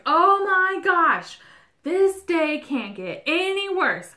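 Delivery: high, loud and slow, like the day is ruined; hammed-up panic at smashing a plate, high and loud; mock freak-out. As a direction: wail it out over a broken plate. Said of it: "you just freak out".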